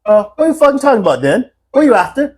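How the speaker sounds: high mock English girl, suspicious; imitated English accent. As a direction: challenge him as a put-on English girl. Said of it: "the English girls over there".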